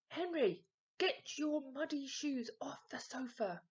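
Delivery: loud angry yell at Henry. A narrator's reading. Dad yelling at Henry over muddy shoes, loud. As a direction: shout it across the house, fed up. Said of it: "yelled Dad".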